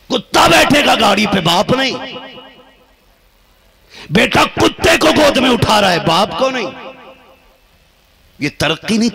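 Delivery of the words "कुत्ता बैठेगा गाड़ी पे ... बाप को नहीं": raised, higher indignant cry; indignant preaching. Real, not acted. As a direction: rail against the times from the pulpit. Preacher rebukes the times. (ऊँची आवाज़ में आक्रोश भरा उपदेश)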